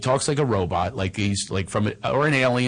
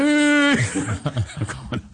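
usual speaking voice

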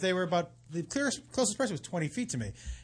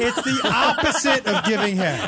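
louder, laughing through it; 4 semitones higher, 7 dB louder than usual. Real, not acted. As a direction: laugh while saying it. Laughing punchline.

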